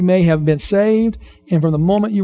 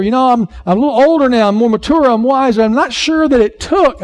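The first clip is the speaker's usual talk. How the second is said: lightly acted earnest kid, higher and quicker; mock younger self; 7 semitones higher, 4 dB louder than usual. Preacher replaying his childhood doubts about baptism, lightly mocking. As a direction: hurry through it higher, as your younger self. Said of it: "I didn't say it that way".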